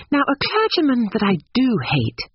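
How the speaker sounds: put-on high, earnest young woman; indignant Lucy. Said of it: "wanting to say something sympathetic".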